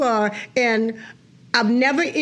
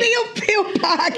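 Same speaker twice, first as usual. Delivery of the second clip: laughing; 8 semitones higher than usual. Real, not acted. Echoing dish name laughing.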